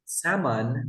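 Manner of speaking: Salmon said as "SAM-un"; silent L in salmon. Shown as a demonstration. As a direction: say Salmon with no L sound. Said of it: "We say salmon".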